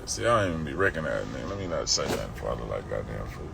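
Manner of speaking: muttered aside. Real, not acted. Unsure if he knows the man, muttering softly to himself. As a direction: mutter to yourself so he can't hear.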